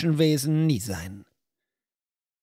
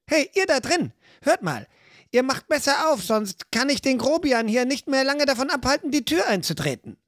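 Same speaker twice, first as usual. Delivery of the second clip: calling out; 10 semitones higher than usual. Acted. Calling through the door.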